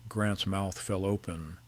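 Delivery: usual speaking voice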